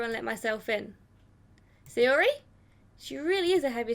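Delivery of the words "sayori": louder, rising questioning call. Acted. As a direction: call for a friend inside the house. Calling into friend's house.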